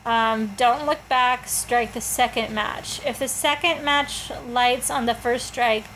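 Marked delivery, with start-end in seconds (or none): none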